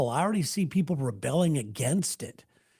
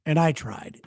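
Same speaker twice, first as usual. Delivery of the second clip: ordinary delivery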